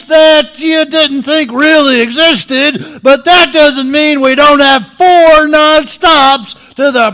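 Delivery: loud announcer pitch. Acted. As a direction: sell it big like a hype announcer. Airline pitch to doomed passengers.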